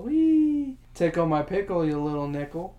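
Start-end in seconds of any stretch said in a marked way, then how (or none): 0.0-0.8 high happy squeal; gleeful squeal. Real, not acted. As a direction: let out a giddy wee.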